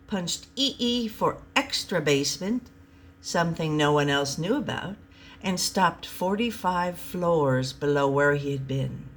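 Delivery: plain reading voice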